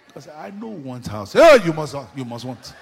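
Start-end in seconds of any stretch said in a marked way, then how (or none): 1.3-2.7 loud, mock-aggressive praying; forceful prayer. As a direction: hammer it out by force. Preacher mimicking forceful prayer.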